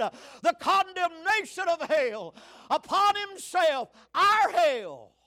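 high, loud preacher's proclamation; preaching at full force. Acted. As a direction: shout the good news to the church. Preaching climax.

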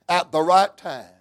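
usual speaking voice

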